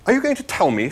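high-pitched irritated snap; snapping. A narrator's reading. Arthur challenging the disapproving animal, snapping. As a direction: snap it indignantly. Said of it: "snapped Arthur".

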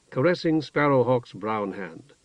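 ordinary delivery, nothing marked

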